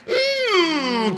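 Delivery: very high creature whimper; long whining hum in a put-on creature voice; Skeksis. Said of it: "don't I sound like a Skeksis".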